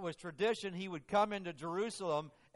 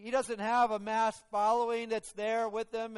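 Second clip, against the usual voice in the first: normal talking voice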